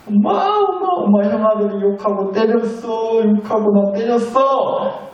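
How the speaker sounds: put-on high whiny child calling mom; sniffling little son. Youngest son running to mom, whiny and teary. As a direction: tattle like a crying little boy. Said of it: "코를 훌쩍거리면서".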